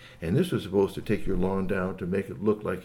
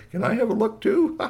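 high, singsong request; high-pitched, amused pleading; playful echo; 11 semitones higher, 3 dB louder than usual. Acted. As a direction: pipe up eagerly, breaking into a laugh. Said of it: "almost as though to say".

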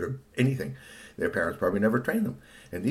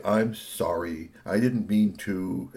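slow, drawn-out sorry; guilty apology; slower than usual. Acted. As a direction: apologize slowly, guilt in every word. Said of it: "feel kind of guilty and say".

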